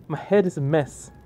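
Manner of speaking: ordinary delivery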